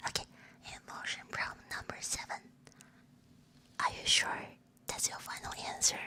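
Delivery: whispered ASMR reading and chat. Real, not acted. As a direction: whisper it all, unvoiced words close to the listener.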